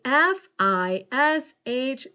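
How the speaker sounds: slow, letter by letter; measured, separated letters; spelling aloud. Shown as a demonstration. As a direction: spell it out slowly for a class. Teacher spelling a word aloud.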